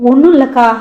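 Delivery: hasty denial. A narrator's reading. Mythili hastily denies.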